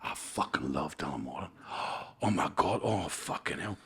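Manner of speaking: imitated Scottish accent, awestruck and gasping; young Scottish fella. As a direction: play an overwhelmed Scottish fan. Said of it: "he was a young Scottish fella".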